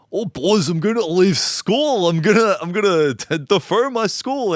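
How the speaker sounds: put-on high, loud, slow Scottish accent; broad Scottish accent. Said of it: "he has a heavy Scottish accent".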